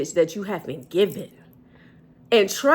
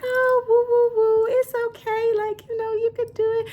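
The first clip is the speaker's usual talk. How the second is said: mock coddling voice; 13 semitones higher than usual. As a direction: parody people who sugarcoat everything. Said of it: "some people like to dance around and like".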